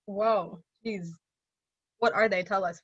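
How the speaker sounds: normal talking voice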